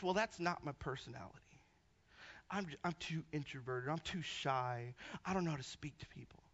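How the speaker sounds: low, very quiet reluctant voice; imitated shy people. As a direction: shrink back softly, imitating someone too shy. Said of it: "hearing people would say".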